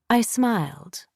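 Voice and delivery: usual speaking voice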